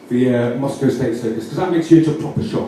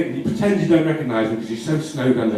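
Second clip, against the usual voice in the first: normal talking voice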